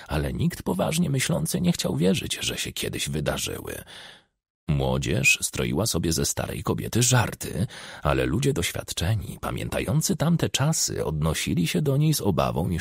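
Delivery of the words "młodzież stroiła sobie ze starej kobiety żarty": ordinary delivery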